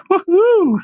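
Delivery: high falsetto whoop; playful, high-pitched cheer; celebratory woohoo. Pretend. Whooping over imagined housekeeper.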